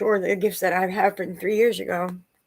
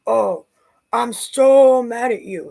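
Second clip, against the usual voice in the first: slow, higher, much louder mock fury; imitating his tantrum; mock angry; 5 semitones higher, 8 dB louder, slower than usual. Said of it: "He's so angry at me".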